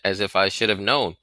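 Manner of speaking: ordinary delivery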